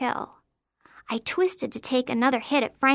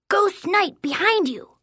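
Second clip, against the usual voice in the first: higher, urgent warning cry; yelled; 7 semitones higher, 3 dB louder, slower than usual. A narrator's reading. Boy warns his friend of a pretend ghost, high yell. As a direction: call out a warning in a make-believe battle. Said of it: "until Franklin yelled".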